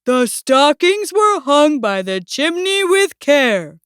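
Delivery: slow, measured recitation; poem recital. A narrator's reading. Bear continues his poem for Mouse, measured recital. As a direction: recite it deliberately at normal volume. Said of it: "Bear continued".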